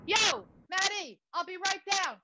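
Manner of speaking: high, stretched-out call. Acted. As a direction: holler to someone down in the street. Son calls down to Maddie.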